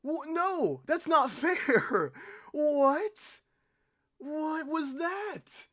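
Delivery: high, loud disbelief at the screen; real shock, yelled high; stunned gamer yelping at a death; shocked outburst. Real, not acted. Gamer's shock after unfair death.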